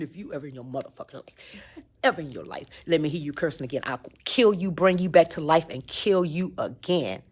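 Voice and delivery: low, soft menacing threat; demon voice. Retold or imitated. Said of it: "I swear I heard a demon voice".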